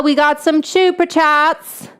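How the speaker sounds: ordinary delivery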